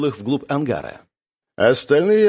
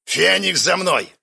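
curt command; 6 semitones higher, slower than usual. A narrator's reading. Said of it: "приказал Карс".

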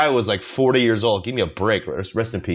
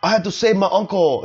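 mock rant; 9 semitones higher than usual.

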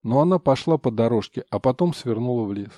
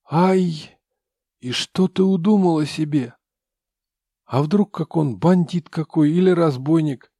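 put-on loud, higher old granny wailing; lamenting grandmother; 4 semitones higher, 7 dB louder than usual. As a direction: fuss and lament like a village granny. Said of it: "причитала бабушка".

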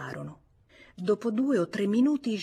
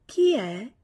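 higher, louder woman hiding who she is; a woman disguising her voice behind a door; wary, disguised female voice through a door; disguised woman's voice; 4 semitones higher, 6 dB louder than usual. A narrator's reading. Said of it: "sforzandosi di rendersi irriconoscibile".

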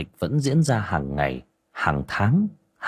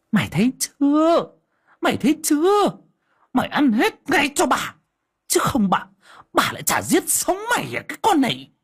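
high, venomous screaming threat; hissing threat. Acted. Stepmother forcing the girl's head down, venomous threat. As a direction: hiss and scream it down at her. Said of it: "miệng rít lên".

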